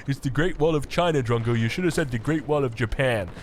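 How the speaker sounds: low, put-on complainer voice; mock triggered viewer. Caster imitating triggered commenters correcting him, mock complaint. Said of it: "They're like".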